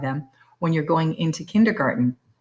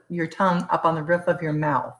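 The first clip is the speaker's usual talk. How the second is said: ordinary delivery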